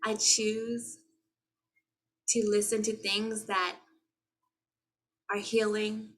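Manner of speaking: spoken affirmations. Acted. Closing the video, reciting soft affirmations to viewers.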